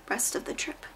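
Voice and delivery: unvoiced whisper; hushed, whispered grumble; whispered aside. Real, not acted. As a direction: breathe it out as a secret aside. Aside about using mom's toothpaste.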